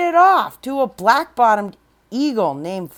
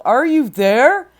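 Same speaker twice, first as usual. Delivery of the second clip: louder searching call; 3 semitones higher, 6 dB louder than usual. Acted. Horton searching clovers, calling for the Whos. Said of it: "and called".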